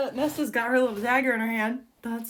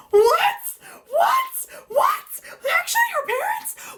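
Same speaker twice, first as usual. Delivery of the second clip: loud, very high-pitched shrieks; real incredulous squeal, each word bursting out; shocked disbelief; 8 dB louder, slower than usual. Real, not acted. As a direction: shriek it in stunned, delighted disbelief. Shocked by news of parents.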